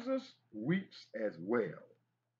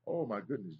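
ordinary talk

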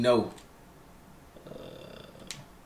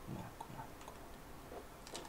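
whispering; 26 dB quieter than usual. Real, not acted. Tense moment in game.

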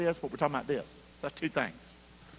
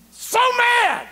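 high, loud furious cry; shouting how mad he was; angry shout; 8 dB louder than usual. Retold or imitated. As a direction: blast it out in anger. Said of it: "I was so angry".

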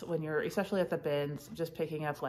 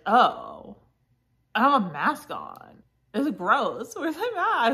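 retold dismay, louder and climbing higher; dismayed realization; 5 semitones higher, 5 dB louder than usual. Reseller retelling forgetting her mask at the bins, mock dismay. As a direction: act out a mock-panicked discovery.

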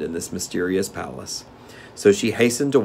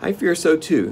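put-on higher-pitched, mildly sorrowful girl; sad; 9 semitones higher, 3 dB louder than usual. A narrator's reading. Girl admits her fear to Tik-Tok, mildly sad. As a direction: agree with a touch of sadness. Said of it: "said Dorothy sadly".

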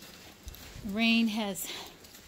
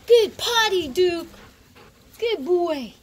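high, loud gushing praise; much higher, louder pet praise; dog praise; 9 semitones higher, 9 dB louder, slower than usual. Real, not acted. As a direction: praise the puppy like it did a trick.

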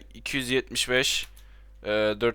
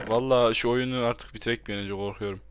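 usual speaking voice

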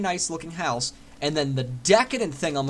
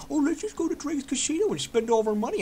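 a higher put-on voice for an eager gambler; voicing excited customers, much higher; mock customer voice; 10 semitones higher than usual. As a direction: play a giddy casino visitor. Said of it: "Everybody could be like".

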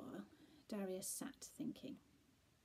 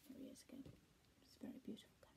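much softer, whispery aside; quiet aside; 13 dB quieter, much faster than usual. Real, not acted. Reader points out the cat to the listener, very quietly. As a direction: drop right down as if confiding. Aside from the story about a picture.

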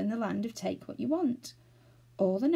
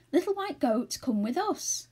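high-pitched, eager call; high storybook cry; 7 semitones higher than usual. A narrator's reading. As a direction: call the animal over, voice lifted. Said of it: "cried Franny".

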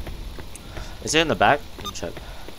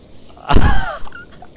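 squeaky burst of laughter, louder; high shocked laugh; 10 dB louder than usual. Real, not acted. Gamer laughing after getting one-tapped.

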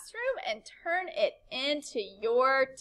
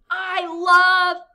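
excited exclamation; 6 semitones higher, 11 dB louder than usual. Shown as a demonstration. Example of an excited sentence.

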